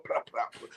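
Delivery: soft breathy plop, plop, plop; imitated key taps. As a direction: tap it out under the breath like keys. Mimicking loud keyboard typing.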